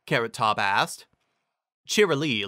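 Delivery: plain reading voice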